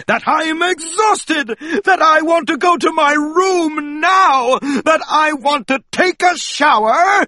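exhausted mouse yelling his name, voice cracking; angry tearful shout. Acted. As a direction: rant at the clerk, near tears. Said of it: "I exploded".

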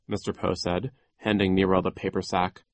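plain reading voice

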